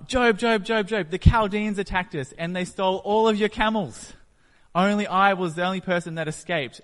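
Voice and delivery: high, loud, breathless calling of the name; panicked messenger blurting the disaster; frantic servant. Retold or imitated. Servant racing up to tell Job of the raid, frantic high shout. Third servant reports stolen camels.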